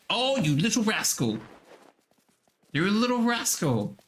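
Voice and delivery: high, fond mock scolding; playful teasing coo. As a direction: tease a naughty pet up high. Teasing the creature she found.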